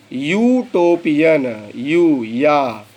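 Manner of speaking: Utopian broken slowly into यू, या; slow pronunciation demo. Shown as a demonstration. Teacher slowly sounds out utopian for students. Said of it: "केवल और केवल उसका उच्चारण".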